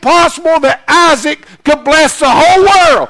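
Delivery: loud, high shout, slowing on the last words; preaching shout. Real, not acted. Preacher shouting to congregation that Isaac's dead, loud and high. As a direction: cry it out to the back row.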